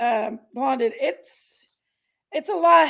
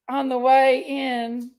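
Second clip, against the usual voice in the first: ordinary delivery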